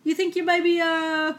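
mock suspicious village policeman in a bad British accent; terrible British accent. Podcaster imitating the village constable questioning newcomers, hammed-up British accent. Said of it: "my terrible British accent".

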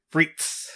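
"frites" said the French way, free. Retold or imitated. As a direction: say "frites" like a snob, free-eet. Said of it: "Or as he refers to them as".

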